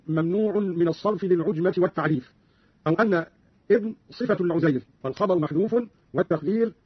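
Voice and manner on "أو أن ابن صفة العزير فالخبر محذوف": usual speaking voice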